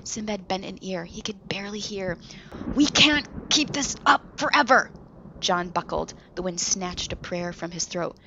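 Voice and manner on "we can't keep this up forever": higher, louder strained voice; a hint of effort; strained through gritted teeth. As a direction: force it out through clenched teeth. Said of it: "Flynn grit his teeth".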